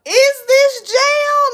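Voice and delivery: loud, high, drawn-out question; exasperated high cry; incredulous. Retold or imitated. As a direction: stretch it out in mock disbelief. Mock reaction to an angry man.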